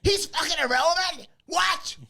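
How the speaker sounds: high, loud, outraged shriek; hammed-up incredulous outburst; losing his mind. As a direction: yell it in disbelief, totally incensed. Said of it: "loses his mind over it".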